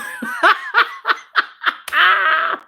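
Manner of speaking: extended cackle trailing into wheezes; long hearty laugh. Real, not acted. Viewer plans to act a fool, host cackles. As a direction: laugh until the breath runs out.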